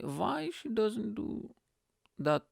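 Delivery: put-on high, slow try at an Indian accent; hesitant, high-pitched Indian accent; attempted Indian accent. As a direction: test out an Indian accent, slowly. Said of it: "learning how to do an Indian accent".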